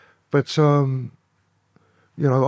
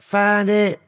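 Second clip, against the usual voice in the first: mock triumphant shout; retold shout; 7 semitones higher than usual. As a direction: call it out like a cop finding the gun. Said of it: "one of them shouted out".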